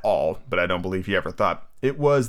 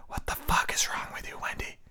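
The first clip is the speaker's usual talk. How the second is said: whispered disbelief; 8 dB quieter than usual. Real, not acted. Incredulous aside to Wendy.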